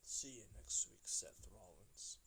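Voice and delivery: low, slow menacing threat; mad threat. Retold or imitated. Lashley warning his rival after being insulted, low slow menace. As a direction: drop it low and drag the name out like a threat. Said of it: "Bobby Lashley gets mad and he says".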